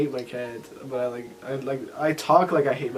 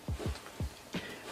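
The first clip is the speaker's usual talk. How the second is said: hushed disbelief; 14 dB quieter, much slower than usual. Retold or imitated. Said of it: "I was like".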